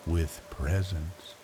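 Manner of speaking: ordinary delivery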